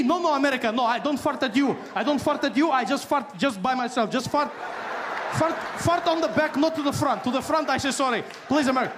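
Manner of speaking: high nervous excuses; high frantic pleading. Pretend. Iran pleads with America, high frantic excuse. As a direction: grovel to the bully.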